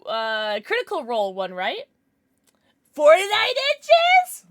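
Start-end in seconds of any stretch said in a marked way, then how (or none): 2.9-4.4 high, loud, slow disbelief; shocked question. Real, not acted. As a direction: gasp it out in disbelief. Reacting to a donation.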